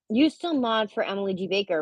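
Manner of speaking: usual speaking voice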